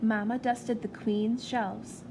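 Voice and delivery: ordinary delivery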